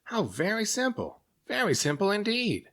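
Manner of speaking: a creature tickled by his simple idea; delighted exclaim. A narrator's reading. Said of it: "he exclaimed".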